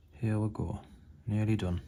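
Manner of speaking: a playful hint of Newcastle accent while painting. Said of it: "Don't know why I'm doing a Newcastle accent".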